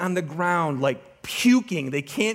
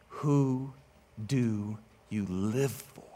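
ordinary talk, nothing marked

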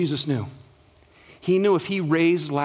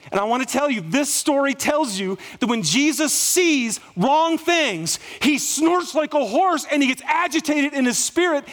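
preaching at full force; 9 semitones higher than usual. Real, not acted. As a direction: lift the church with rising fervour.

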